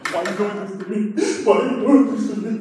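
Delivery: soft, hushed mock whine; acted little-brother protest; whimpered pleading. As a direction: plead helplessly under your breath.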